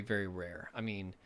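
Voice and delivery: usual speaking voice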